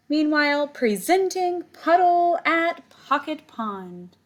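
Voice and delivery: showman announcing. Acted. Teacher announcing Puddle on the ice, slow grand showman voice. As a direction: present him like a star act.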